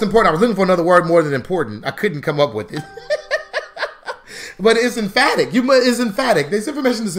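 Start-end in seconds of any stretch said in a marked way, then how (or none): 2.9-4.6 high, wheezy soft laughter; real laugh. Real, not acted. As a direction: laugh at your own lost word. Laughs at failing to find word.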